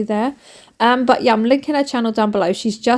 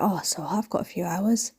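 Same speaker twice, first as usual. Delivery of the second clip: softer whispered inner thought; 3 semitones lower, 8 dB quieter than usual. Retold or imitated. Vlogger voicing her relaxed inner thought in a whisper. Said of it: "you're like".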